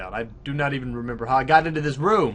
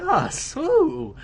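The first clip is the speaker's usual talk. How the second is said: excited whoop; 12 semitones higher, 4 dB louder than usual. Real, not acted. Excited at plot reveal.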